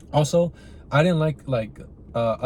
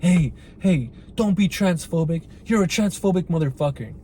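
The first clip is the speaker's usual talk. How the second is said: mock outrage; 5 semitones higher than usual. Mocking an outraged scold.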